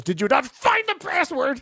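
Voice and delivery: sudden high-pitched outburst; mock exasperated yell. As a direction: burst out in high, put-on disbelief. Mock outburst about a lost password.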